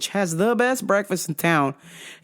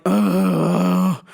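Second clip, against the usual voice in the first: groan; 9 semitones lower, 3 dB louder than usual. Retold or imitated. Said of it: "I was like".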